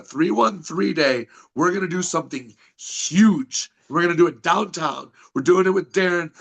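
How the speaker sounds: promo hype. Acted. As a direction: hype the shows like a ring announcer. Wrestler hyping upcoming events.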